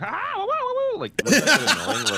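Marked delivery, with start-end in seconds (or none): 0.0-1.0 piercingly high imitated whoops; loud mock game-hero squeals; high-pitched Mario whooping. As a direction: whoop in a cartoon falsetto. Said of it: "ninety minutes of hearing".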